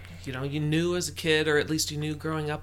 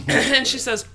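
usual speaking voice

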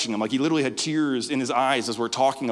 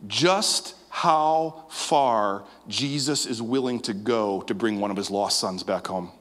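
tender preaching; 5 semitones lower, much slower than usual. Acted. Preaching climax.

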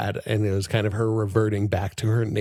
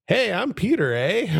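put-on jokey high Canadian accent, much higher; 11 semitones higher than usual. Podcaster fakes a Canadian to his cohost, high Canadian accent. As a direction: ham up a Canadian "eh". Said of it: "pick up a Canadian accent".

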